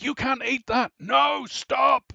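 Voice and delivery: put-on high, loud panicked yell to the kid; panicked shout. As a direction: stop him from eating, yelling in panic. Headmaster knocks cake away.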